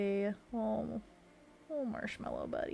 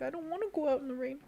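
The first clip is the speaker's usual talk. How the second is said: higher, quick frog voice; reluctant frog; 7 semitones higher, faster than usual. Acted. Frog sticker under a mushroom, reluctant little voice. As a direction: play a little frog balking at the rain. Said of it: "and it's like".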